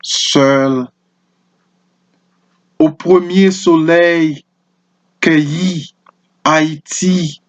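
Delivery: measured French poem reading with pauses between lines; poetry recitation. Acted. Presenter reading a Haitian poem to listeners, poetry recitation. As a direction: recite it line by line, letting each phrase stand.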